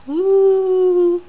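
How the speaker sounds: high, loud playful whoop; silly woo. Real, not acted. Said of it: "I'm in a bit of a strange mood today".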